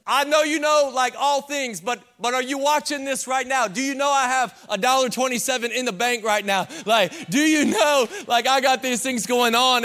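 high, anxious pleading to God; pleading complaint. Pretend. Believer complaining to God about money, pleading. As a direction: plead with him, half joking. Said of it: "looked at God like that and just been like".

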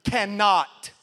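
"cannot" punched loud and firm; emphatic, separated "cannot"; stress on cannot. Shown as a demonstration. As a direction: hit "cannot" hard, both syllables weighted. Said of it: "place some emphasis on this word".

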